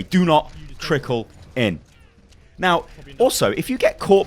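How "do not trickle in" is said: slow, deliberate repeat of the rule; slow final reminder. Shown as a demonstration. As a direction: hammer the rule in once more. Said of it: "we've got that out of the way".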